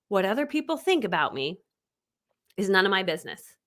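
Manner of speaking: spaced out, slightly low, for note-taking; deliberate, paused delivery; measured statement. Shown as a demonstration. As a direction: dictate it phrase by phrase with a pause. Dictating a statement to write down.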